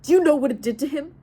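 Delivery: loud, snarling fury. Acted. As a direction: bite out every word in rage. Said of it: "I snarled".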